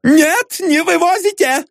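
very high squealing objection; frantic falsetto protest; panicky protest. A narrator's reading. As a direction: squeak out a desperate refusal. Owner protests furniture being taken.

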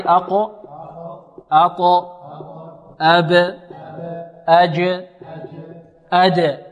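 short echoed release ending أب، أج، أد; qalqala drill. Shown as a demonstration. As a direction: bounce the final consonant in أقو، أطو، أب، أج، أد. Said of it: "حروف قلقلة قطب جد". (قلقلة أقو، أطو، أب، أج، أد)

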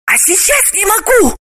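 a hint of a hiss in a high woman's voice; desperate hiss. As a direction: plead desperately, high pitched. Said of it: "отчаянно прошипела Галина".

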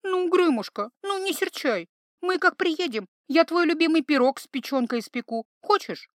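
butter him up; wheedling. Acted. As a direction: healer coaxes the troll with pie. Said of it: "Заюлила та".

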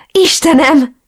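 high, overjoyed cry of disbelief; emotional high-pitched exclamation; screamed out. A narrator's reading. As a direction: cry out as a loved one appears. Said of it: "Sikoltottam fel".

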